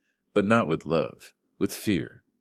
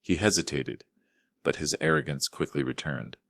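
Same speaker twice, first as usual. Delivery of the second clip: plain reading voice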